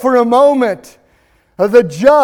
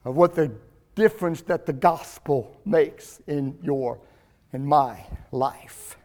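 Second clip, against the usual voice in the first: 1 hushed intimacy; 9 semitones lower, 12 dB quieter than usual. Real, not acted. Preacher drops to intimate tone.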